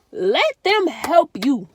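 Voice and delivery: loud, slow, drawn-out plea; pleading emphasis. Real, not acted. Urging depressed viewers to accept help, slow loud plea. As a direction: stretch it out like begging them. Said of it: "Real talk".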